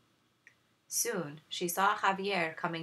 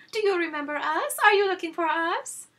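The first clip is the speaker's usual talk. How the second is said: much higher, louder flirty sing-song; simpering sisters; 13 semitones higher, 8 dB louder than usual. Acted. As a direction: flutter at the suitor.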